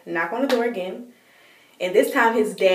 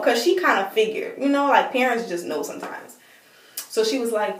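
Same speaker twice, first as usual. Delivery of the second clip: ordinary delivery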